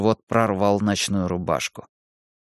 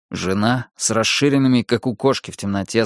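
ordinary delivery, nothing marked

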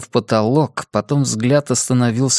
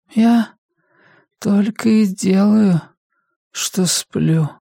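high, slow, halting patient's voice; a tired mother complaining weakly; frail, weary mother; 9 semitones higher, slower than usual. Acted. Mother answers son's urging to sleep, frail and weary.

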